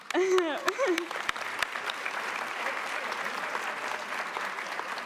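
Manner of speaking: a real sob that comes out half as a laugh; tearful laugh-sob. Real, not acted. Overwhelmed student at the podium, real tearful laugh-sob. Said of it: "I'm sorry for getting emotional".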